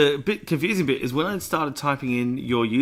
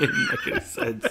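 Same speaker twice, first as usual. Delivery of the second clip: breaking up into high laughter; cracking up at a silly joke; real laughter; faster than usual. Real, not acted. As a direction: laugh helplessly through the words.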